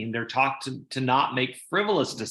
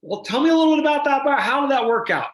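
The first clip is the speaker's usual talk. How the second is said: high, loud, quick coaxing voice; bright quick prompt to the customer; playful high prompt; 11 semitones higher, 5 dB louder than usual. Retold or imitated. Asking the client's wife, high and fast. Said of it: "And we said".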